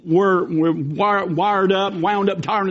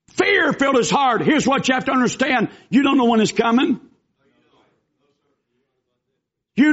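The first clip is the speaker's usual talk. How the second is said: raised, urgent pulpit voice; preaching; 5 semitones higher than usual. Acted. Preacher warning listeners trouble comes suddenly, urgent preaching. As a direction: drive the warning home to the pews.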